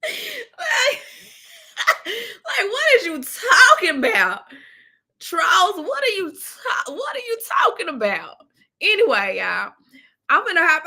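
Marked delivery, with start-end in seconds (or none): 0.0-8.4 high, laughing what is you talking about; real laughter, higher; laughing disbelief. Real, not acted. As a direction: laugh through a mocking question to the trolls. Laughing mock at trolls.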